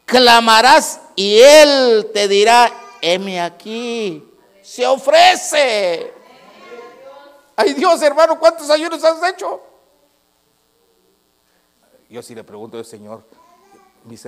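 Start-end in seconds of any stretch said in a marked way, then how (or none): 0.0-9.6 high, loud, slow preacher's cry; preaching at full force. Acted. Pastor preaching scripture to the congregation, at full force. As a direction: proclaim it to the whole church, drawn out.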